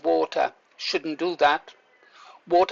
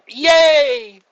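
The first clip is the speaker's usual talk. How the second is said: much higher and louder burst of relief; joyful yay; 9 semitones higher, 9 dB louder than usual. Retold or imitated. Man recalling breathing again after choking, bursting out in joyful relief. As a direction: shout it out in sudden relief. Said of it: "I burst out like that".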